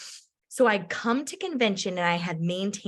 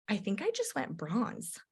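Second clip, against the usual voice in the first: quiet; 8 dB quieter than usual. Retold or imitated. Friend quietly unsure about rank.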